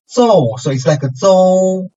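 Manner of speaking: zou starting high, then falling fast; fourth tone. Shown as a demonstration. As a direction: drop zou sharply from the top. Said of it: "is as high as the first tone".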